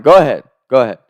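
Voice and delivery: loud taunting go ahead; defiant dare. Retold or imitated. Boy daring the lunch lady to get the principal, loud. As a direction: dare her to do it.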